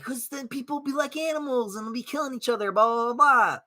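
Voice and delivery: high mock whining voice rattling it off; mocking opponents. Streamer mimicking critics' reasoning, high mock whine. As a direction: parody the critics' argument, dismissively. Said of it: "And I'm like".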